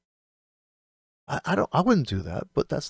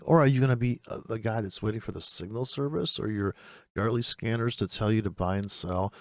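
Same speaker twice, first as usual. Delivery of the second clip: usual speaking voice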